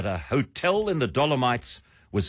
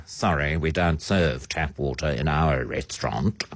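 low-pitched snooty waiter voice; 8 semitones lower than usual. Acted. Restaurant staff refusing tap water, snootily. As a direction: refuse the customer with mock snootiness. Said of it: "where they say, snootily".

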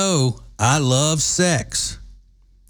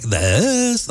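put-on young woman voice; 8 semitones higher than usual. Shown as a demonstration. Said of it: "the typical young woman voice like".

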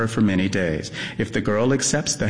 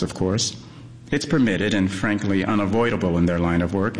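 plain reading voice

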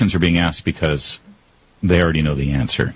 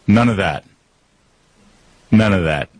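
usual speaking voice

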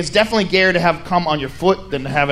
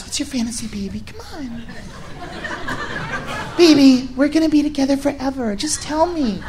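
put-on soft, whispery coaxing girlfriend; 5 semitones higher, 6 dB quieter than usual. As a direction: coax him in a hushed breathy purr.

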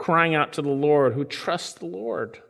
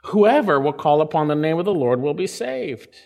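usual speaking voice

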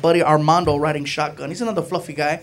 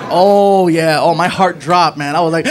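usual speaking voice

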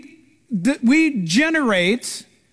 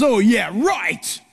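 slow, drawn-out sarcastic scoff; skeptic's mocking retort; 2 semitones lower, much slower than usual. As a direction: roll your eyes at it. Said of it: "The person who is almost comfortable worrying".

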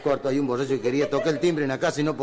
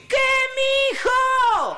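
very high, loud, stretched holler; old man's shout back; 6 dB louder, much slower than usual. Acted. Old Tata answering his boy from afar, long high shout. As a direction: holler back across the countryside. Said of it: "Al rato le contestó el viejito".

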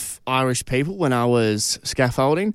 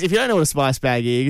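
normal talking voice

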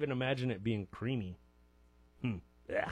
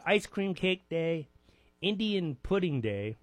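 ordinary talk, nothing marked

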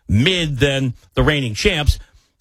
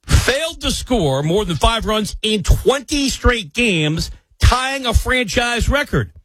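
slow, deliberate read, pitched higher; 6 semitones higher, slower than usual. Real, not acted. Astonished host reading the Yankees' scoring slump, slowly and deliberately. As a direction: spell out the stat slowly so it sinks in. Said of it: "gonna read this slowly".

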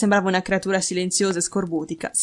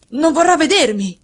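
loud, raised-pitch outburst; distressed cry; 7 semitones higher, 6 dB louder than usual. A narrator's reading. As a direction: cry out in protest, higher and louder. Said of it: "gridò Ferguson". (grido di protesta)

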